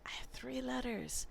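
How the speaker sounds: whispered, like a secret to the class. Real, not acted. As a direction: drop to a conspiratorial whisper. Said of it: "whispered aside to kids".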